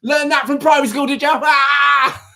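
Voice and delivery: high, loud, gloating shout at Grace; mock triumphant shout. Taunting Grace about primary school, high and loud. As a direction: crow over her win like a playground rival. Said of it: "Just swoop in and be like".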